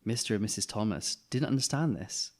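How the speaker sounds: ordinary delivery, nothing marked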